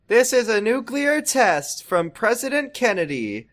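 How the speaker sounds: high, slow mock announcer; lightly voiced intercom announcement. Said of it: "doing a funny air raid siren on, over the intercom".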